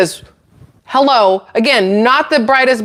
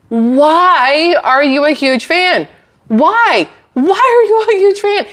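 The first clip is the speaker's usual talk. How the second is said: incredulous why; 7 semitones higher, slower than usual. Real, not acted. Baffled at the investor's reasoning.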